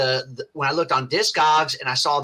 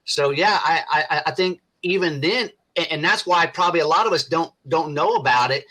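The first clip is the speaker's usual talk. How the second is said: ordinary talk, nothing marked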